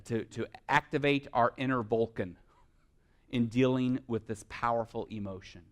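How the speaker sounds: normal talking voice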